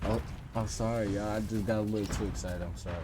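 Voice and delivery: soft, sinking apology; subdued apology. Real, not acted. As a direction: drop the voice and apologise quietly. Apology repeated, trailing down.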